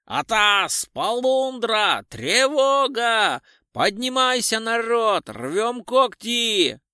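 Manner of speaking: high, loud, slow drawn-out alarm cries; wild yell. A narrator's reading. Drunk tramp yelling to wake his mates, wild and loud. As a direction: bellow the alarm like a drunk raising the whole camp. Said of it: "заорал диким голосом".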